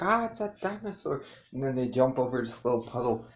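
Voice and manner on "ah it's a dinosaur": high-pitched pretend scream of alarm; mock panic. Friends running from the pretend dinosaur, high mock panic. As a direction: imitate fleeing in play terror, high-pitched. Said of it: "they're running like".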